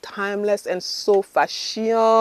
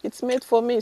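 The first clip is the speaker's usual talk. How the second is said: excited squeal; 9 semitones higher than usual. Retold or imitated. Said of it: "I'm like".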